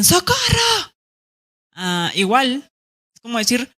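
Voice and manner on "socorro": high urgent cry of socorro; a rising shout for help; calling for help. Shown as a demonstration. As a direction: yell it like you're in danger. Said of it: "el socorro también".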